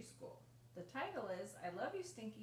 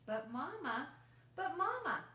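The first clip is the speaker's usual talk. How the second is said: put-on loud, high insistent child voice, rising on the second call; a kid tugging at mom's sleeve, louder and higher; pleading child; 7 semitones higher, 6 dB louder than usual. A narrator's reading. Child pleads with mother in story.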